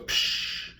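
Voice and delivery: soft unvoiced whoosh; whooshing psh. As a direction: breathe out a quick psh. Imitating swimmers taking off.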